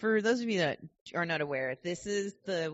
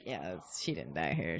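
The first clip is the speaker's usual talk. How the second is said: low, hushed aside to husband; retold whisper; 12 semitones lower, 5 dB quieter than usual. Wife privately doubting the tour's story to her husband, retold whisper. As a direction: imitate murmuring privately, pitch dropped low. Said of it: "I whispered to my husband".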